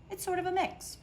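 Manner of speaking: wry. Acted. Bemused uncertain admission.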